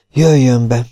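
plain reading voice

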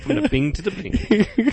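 slow, laughing through the slogan. Real, not acted. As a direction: chuckle the slogan out slowly.

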